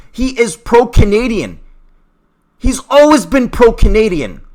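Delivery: high, loud indignant protest; emotional outburst. Real, not acted. Vlogger correcting people who twist words, heated. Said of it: "I'm getting a little emotional here".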